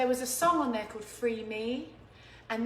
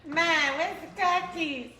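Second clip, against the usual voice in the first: slow, slightly louder Boston voice calling Ma; Boston accent; 5 semitones higher, 4 dB louder, slower than usual. Retold or imitated. Imitating how Cody talks at home, Boston accent. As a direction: imitate a son from Boston calling his mother. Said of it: "Cody talks like this when he goes home".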